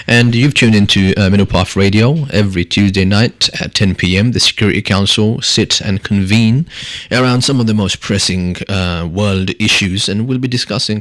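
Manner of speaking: loud, upbeat radio host intro; radio announcer. Acted. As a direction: boom it out like a show host. Host opens radio show.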